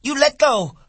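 piercingly high, loud demand; crying out. A narrator's reading. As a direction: cry out to be released. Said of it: "she cried".

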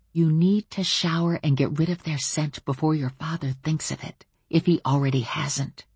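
plain reading voice

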